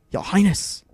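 tight-lipped villain's reply; grudging reply through tight lips. A narrator's reading. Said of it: "he replied, tight-lipped".

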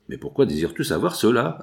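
plain reading voice

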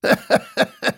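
high-pitched acted cackle; short staccato chuckle; old man's guffaw. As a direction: burst out laughing as an old grandad. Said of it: "Расхохотался дед Ефим".

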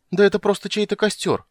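very high, overwhelmed voice; high-pitched, touched and elated; tearfully delighted. A narrator's reading. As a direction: babble with relief and joy. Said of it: "едва не пустив слезу от восторга и умиления".

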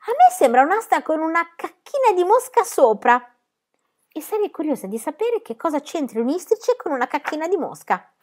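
put-on high-pitched, sceptical little girl; high child voice, lively and curious; cheeky child character. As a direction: question it like an impudent child.

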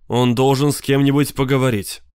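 plain reading voice

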